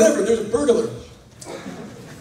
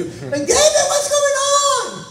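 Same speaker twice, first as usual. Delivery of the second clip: annoying wife impression; much slower than usual. Wife jumps up and calls to husband, hammed-up annoying voice. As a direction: screech it like a shrill spouse. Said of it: "My wife sounds annoying when I do that".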